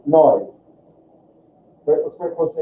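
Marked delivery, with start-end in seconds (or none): 0.0-0.5 "noi" said clearly; syllable demo. Shown as a demonstration. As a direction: model "noi" firmly. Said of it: "So N E U".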